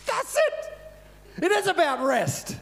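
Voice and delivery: high, loud eureka cry; elated realization. Retold or imitated. Preacher realizing his message is rest, elated. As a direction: exclaim it high, as a sudden revelation. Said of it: "I laughed out loud. I'm going".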